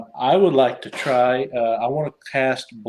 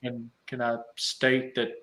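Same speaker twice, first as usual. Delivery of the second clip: normal talking voice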